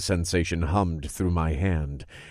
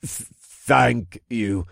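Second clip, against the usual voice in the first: slow, stuttered nervous thanks; stuttering; 4 semitones higher, much slower than usual. A narrator's reading. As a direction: stutter it out, shaken. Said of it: "Aegolos stuttered".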